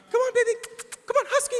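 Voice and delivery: very high-pitched pet-coaxing voice; wife coaxing animal. Retold or imitated. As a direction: imitate a woman sweetly calling a dog, way up high. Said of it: "'cause my wife talks to animals".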